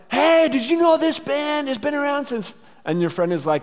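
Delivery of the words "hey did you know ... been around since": high, loud yell over a band; shouting over music. Retold or imitated. Friend at a polka concert, shouting over the band.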